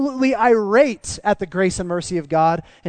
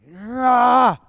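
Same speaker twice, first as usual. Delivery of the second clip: loud, high mock roar; angry roar; 7 semitones higher, 8 dB louder than usual. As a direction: burst out with a wordless roar of anger. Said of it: "filled with anger and be like".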